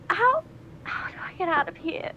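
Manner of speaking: panicked. Acted. Minx keeps looking around for escape, panicked.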